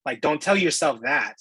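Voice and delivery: usual speaking voice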